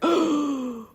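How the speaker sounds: sharp awestruck gasp. Acted. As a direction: gasp as if starstruck. Characters react on seeing her.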